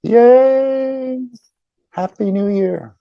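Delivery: high, loud party cheer; mock cheer. Shown as a demonstration. A hollow party greeting, high and cheery. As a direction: shout it like a New Year toast. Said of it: "beyond the outer shell of".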